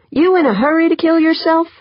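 high, challenging question; demanding. Acted. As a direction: challenge her with alarmed disbelief. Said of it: "Pancho demanded".

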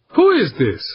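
high, irritated challenge; annoyed demand. A narrator's reading. Comm operator challenges a suspected prankster, annoyed demand. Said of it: "he demanded".

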